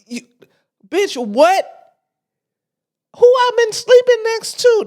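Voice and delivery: high, loud outraged husband voice; bursting with disbelief at his wife. Retold or imitated. Husband blowing up at his wife, loud and outraged. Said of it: "he wanted to slap the fuck out of her".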